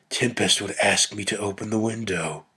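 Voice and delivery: ordinary delivery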